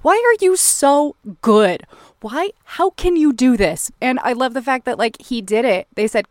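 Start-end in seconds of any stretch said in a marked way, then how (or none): 0.0-3.9 half-shouted, higher frustrated praise for the actor; exasperated awe. Retold or imitated. Fan marvelling at Paul Newman's scene, loud incredulous praise. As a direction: yell at him for being too good, high and loud. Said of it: "I'm like".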